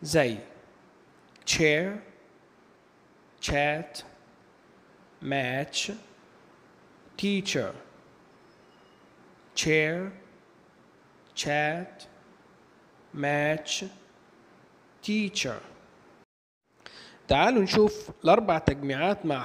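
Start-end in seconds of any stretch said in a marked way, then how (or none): 1.5-7.7 "chair, chat, match, teacher" with a clear t-sh sound for CH. Shown as a demonstration. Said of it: "أغلب الأحيان بينطق تشا". 9.6-15.6 "chair, chat, match, teacher" with a clear t-sh sound for CH. Shown as a demonstration. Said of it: "أغلب الأحيان بينطق تشا".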